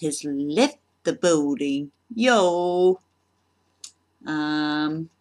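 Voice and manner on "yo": a loud, higher hammed-up "Yo!"; mock [name] shout. Job applicant hams up an [name] fan, loud mock shout. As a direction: bellow it like a showy [name] fan.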